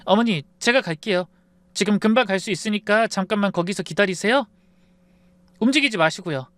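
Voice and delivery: urgent voice. Acted. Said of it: "급한 목소리로 말했습니다".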